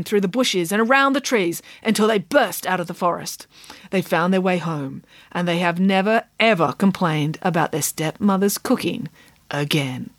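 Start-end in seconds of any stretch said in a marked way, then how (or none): none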